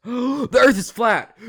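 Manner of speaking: loud, high, slow gasp of mock shock. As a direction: act stunned by the discovery. Mock shock at flat Earth.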